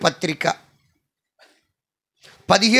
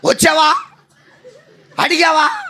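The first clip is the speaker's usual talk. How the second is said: high, loud indignant volleys with a laugh; mock angry retort; 9 semitones higher, 3 dB louder than usual. Woman snapping back at the preacher's question, high and loud. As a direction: fire back each question, offended. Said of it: "అన్నట్టు ఉండాలి".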